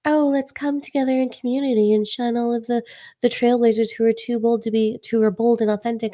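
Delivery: fake-nice voice mocking the community; passive aggressive. Mocking people who shun bold trailblazers, fake sweet. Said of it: "the passive aggressive energy is like".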